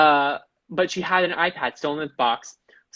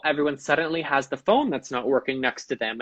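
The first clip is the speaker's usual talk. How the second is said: ordinary talk, nothing marked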